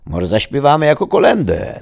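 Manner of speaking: high, loud village wife; sudden suggestion. A narrator's reading. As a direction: blurt out the idea. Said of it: "zaproponowała nagle Danuta". (nagle, wysokim głosem)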